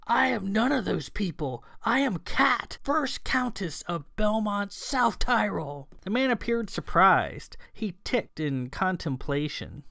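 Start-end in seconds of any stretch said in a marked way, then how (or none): 0.1-5.9 high-pitched indignant declaration; growing anger. A narrator's reading. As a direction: assert yourself crossly, pitch raised throughout. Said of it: "Kat was becoming angry".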